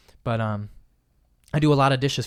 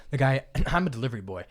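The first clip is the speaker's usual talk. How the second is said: normal talking voice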